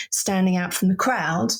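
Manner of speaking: usual speaking voice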